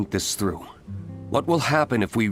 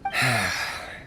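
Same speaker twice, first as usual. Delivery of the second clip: soft falling exhale; resigned sigh; 3 dB quieter than usual. Acted. Answering a child's insistence on Dodo King, resigned sigh. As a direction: let out a weary sigh.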